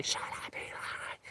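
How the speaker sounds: a breathless whispered shut-up at the phone; whispered mock scream. Shown as a demonstration. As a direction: whisper the yell, no voice at all. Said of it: "scream at the phone".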